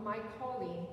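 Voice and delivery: ordinary talk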